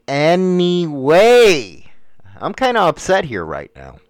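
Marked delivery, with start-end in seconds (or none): 0.1-1.8 slow falling anyway. Shown as a demonstration. Repeated anyway, changing delivery.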